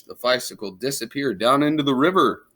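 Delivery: ordinary delivery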